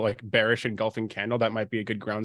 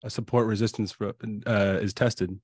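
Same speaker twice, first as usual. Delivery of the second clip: lightly voiced trading guru; impression of co-host. Guest shows streamer his impression of him, mock lecturing. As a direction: mimic a co-host lecturing on charts. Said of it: "my impression of you".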